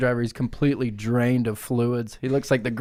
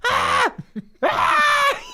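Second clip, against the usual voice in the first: mock scream. Q crushed under Grimace, hammed-up very high screams. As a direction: shriek in mock pain, laughing.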